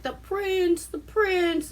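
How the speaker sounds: high, eager crowd chatter, mocked; villagers all abuzz. Villagers gushing as the prince's carriage arrives, mock excited. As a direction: mimic a crowd gushing over royalty. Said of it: "everyone's like".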